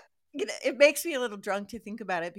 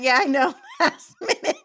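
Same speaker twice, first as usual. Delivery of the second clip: high, loud words through laughter; laughing; 12 semitones higher, 9 dB louder than usual. Real, not acted. Host greets Roxanne over a last-minute booking, laughing. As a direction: laugh your way through it, pitched up.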